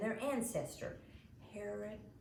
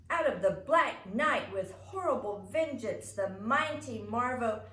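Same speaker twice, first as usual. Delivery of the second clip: loud, higher comic-book hero announcement; dramatic declaiming; 4 semitones higher, 10 dB louder than usual. Acted. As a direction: boom it out like a comic narrator.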